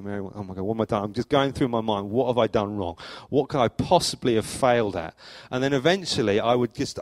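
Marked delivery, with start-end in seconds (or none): none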